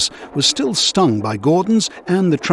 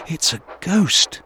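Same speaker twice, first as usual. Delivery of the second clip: slow, hushed and higher, frightened; scared whisper of a ghost; 4 semitones higher, slower than usual. A narrator's reading. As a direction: breathe it out as if spotting a ghost. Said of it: "Thomas was very afraid".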